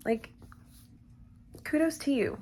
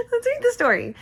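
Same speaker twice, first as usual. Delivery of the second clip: high squeal straight out of a laugh; giggly, high-pitched restart; 12 semitones higher, 4 dB louder, faster than usual. Real, not acted. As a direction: say it high through leftover giggles. Repeats it straight after laughing.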